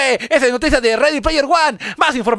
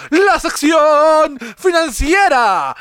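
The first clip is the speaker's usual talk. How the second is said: news anchor voice; 6 semitones higher, much slower than usual. Acted. Parody news segment intro.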